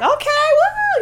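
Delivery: high excited whoop; crowd cheering. Retold or imitated. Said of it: "They were just like".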